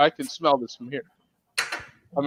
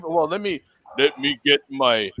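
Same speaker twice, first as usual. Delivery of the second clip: usual speaking voice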